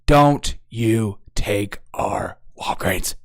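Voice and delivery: slow, breathy mock-menacing whisper. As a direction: hiss the warning very slowly.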